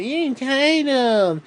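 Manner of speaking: high, slow mocking sing-song; mocking people. Ranter mocking critics who call him entitled, high slow sneer. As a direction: parrot the accusers with a sneer. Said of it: "sick and tired of people saying that".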